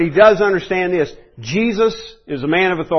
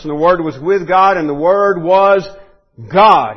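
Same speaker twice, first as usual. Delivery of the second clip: rising to a loud final God; building proclamation; 3 semitones higher, 8 dB louder than usual. Real, not acted. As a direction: build and hit God hard. Quoting John, building to climax.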